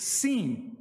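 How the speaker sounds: seen with a clear s sound; Hebrew letter sin. Shown as a demonstration. Teacher contrasting the Hebrew letters shin and sin. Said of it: "If the dot is on the left".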